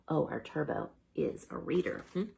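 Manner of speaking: ordinary delivery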